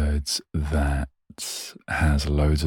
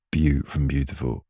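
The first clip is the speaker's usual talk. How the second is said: ordinary delivery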